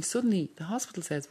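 normal talking voice

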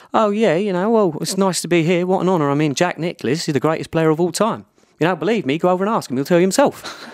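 high, gushing flattery; mock fawning voice. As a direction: lay the praise on thick, high up. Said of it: "I was like".

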